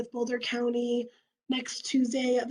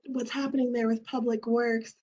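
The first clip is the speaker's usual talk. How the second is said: ordinary talk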